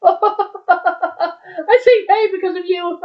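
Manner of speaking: high, giggly talk through laughter; laughing. Real, not acted. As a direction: get the words out while cracking up. Laughing about the slip.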